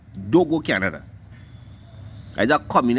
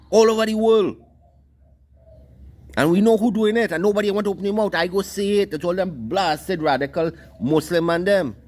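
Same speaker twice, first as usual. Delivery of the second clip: heated rant; 6 semitones higher than usual. Real, not acted.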